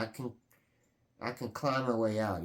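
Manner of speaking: ordinary talk, nothing marked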